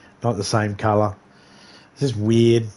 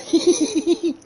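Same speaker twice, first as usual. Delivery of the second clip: high-pitched mimicked giggle; squeaky-high little tee-hee laugh; imitated giggle. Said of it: "And then giggles".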